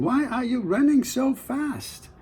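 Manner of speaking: high, curious fisherman's voice. Acted. Fisherman to running farmer, high and curious.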